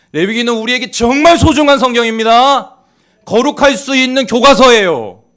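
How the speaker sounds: very loud, high-pitched pulpit shout; impassioned shouted exhortation; full-force preaching. Real, not acted. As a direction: cry it out to rouse the congregation.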